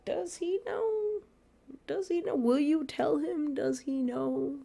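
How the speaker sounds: high, soft, slow teasing. Retold or imitated. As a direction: needle them with a sing-song. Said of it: "I was like".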